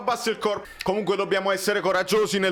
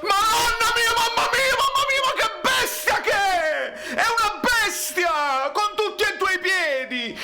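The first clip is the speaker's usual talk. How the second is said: excited shouting; 10 semitones higher than usual. Real, not acted. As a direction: yell it like a last-minute winner. Fan screaming at a goal.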